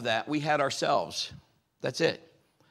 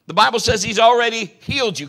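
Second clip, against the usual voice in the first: preaching loud; 7 semitones higher, 11 dB louder than usual. Acted. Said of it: "repeats line, second time climactic".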